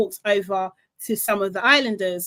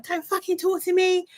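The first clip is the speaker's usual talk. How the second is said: mock furious snap, pitched up; imitating an angry snap; 8 semitones higher than usual. Recapping Huda telling Jeremiah off, mock angry snap. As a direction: play Huda's annoyed outburst, lightly hammed. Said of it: "She's so annoyed".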